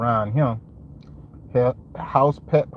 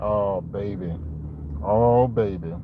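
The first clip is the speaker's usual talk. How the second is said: ordinary talk, nothing marked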